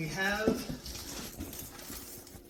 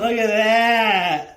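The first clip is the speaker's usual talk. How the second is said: loud, slow, amazed exclamation; excited; 10 dB louder, much slower than usual. Real, not acted. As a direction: show it off in awe. Belt revealed.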